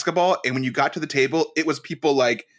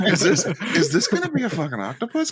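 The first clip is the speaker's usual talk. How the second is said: laughing disbelief; 4 semitones higher, faster than usual. Retold or imitated. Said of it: "I was like".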